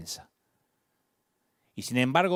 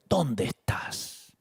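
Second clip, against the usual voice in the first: drawn out and hushed; God gently asking Adam, slow and hushed; very slow tender question; 4 semitones lower, 3 dB quieter, much slower than usual. Shown as a demonstration. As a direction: ask it slowly, softly, as a gentle call. Quoting God, drawn out softly.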